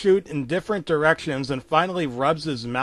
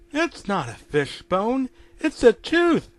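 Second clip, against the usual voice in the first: higher, puzzled wife's voice; Muriel puzzled; 9 semitones higher than usual. Acted. Wife finding a tooth in her meal, puzzled. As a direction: work it out in a higher voice.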